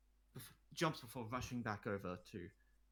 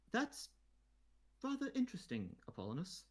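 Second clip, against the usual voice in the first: much higher, strained polite reply; forced politeness; 10 semitones higher than usual. Acted. Queen hides her embarrassment from the boy, much higher and forced. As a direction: cover embarrassment with a too-sweet tone. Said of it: "try your best to hide your embarrassment".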